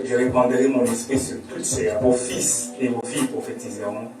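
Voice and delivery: ordinary talk, nothing marked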